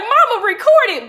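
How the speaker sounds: high, loud mock plea; urgent calling out. Arrestee calling to mama to film it, urgent shout. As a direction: call urgently for a witness. Retold call to mother during arrest.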